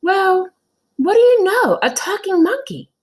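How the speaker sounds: very high, loud amazed exclamation; astonished. Acted. Teacher amazed the monkey talks, very high and loud. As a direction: marvel at a talking monkey. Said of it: "nearly fell off her chair".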